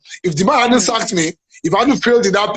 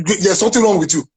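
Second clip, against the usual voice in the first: ordinary delivery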